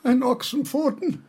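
high tearful cry from a nightmare. Acted. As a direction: whimper it out, half sobbing. Dying Kant cries out, nightmare.

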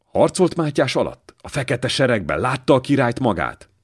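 put-on a boy thrilled to meet a soldier of the king; childlike eager curiosity. A narrator's reading. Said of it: "gyerekes kíváncsiság csillant".

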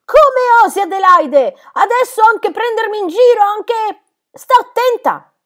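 high, very loud outraged shriek; blazing anger. A narrator's reading. Governess thinking Heidi mocks her, blazing with anger. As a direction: explode with indignation at full voice. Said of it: "l'ira della signorina divampò".

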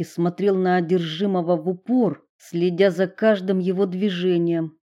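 usual speaking voice

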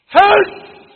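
high, thundering single-word cry; shouted plea. Real, not acted. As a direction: shout the command with all your force. Repeats the shouted appeal. (صرخة وعظ مدوية)

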